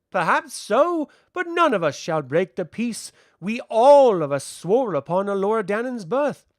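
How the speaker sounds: put-on high, loud child figure; bold child envoy, firm. A narrator's reading.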